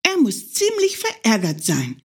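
a hare thinking it over. Acted. Hare muses to himself, lightly high. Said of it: "dachte der kleine Hase".

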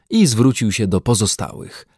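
ordinary delivery

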